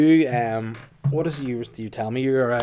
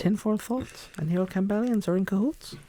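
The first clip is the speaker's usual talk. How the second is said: put-on camp it up as the old Campbellian; 8 semitones higher than usual. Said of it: "old Campbellian voice".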